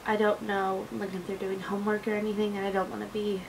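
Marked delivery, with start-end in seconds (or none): none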